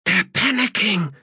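very high, strained hiss, still voiced. A narrator's reading. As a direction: hiss it urgently in a high character voice. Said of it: "hissed Stalock".

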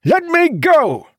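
very high, slow, straining yell; furious shout. A narrator's reading. Captain struggling against soldiers who grab him, shouting. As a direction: scream it, stretching the words. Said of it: "he shouted".

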